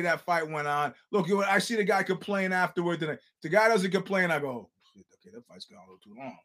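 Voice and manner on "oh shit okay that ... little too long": very soft, low aside; muted realization. Retold or imitated. Viewer seeing the fight has gone too long, hushed. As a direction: say it under the breath, low and quick.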